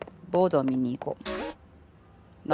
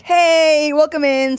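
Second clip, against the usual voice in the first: much higher, louder bright welcome; energetic greeting; 10 semitones higher, 5 dB louder, slower than usual. Shown as a demonstration. Streamer demos her energetic voice, high and loud. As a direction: greet like a cheerful shop clerk. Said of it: "when I inject a bit more energy and excitement".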